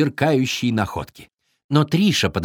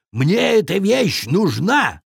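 slow, insistent bear demand with raised pitch; growling bear; 8 semitones higher, slower than usual. A narrator's reading. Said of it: "рыкнул медведь".

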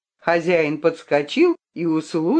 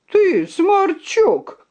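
slow, high, louder insult; angry shout; 12 semitones higher, 3 dB louder, much slower than usual. A narrator's reading. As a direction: spit the insult out. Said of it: "крикнул рыцарь".

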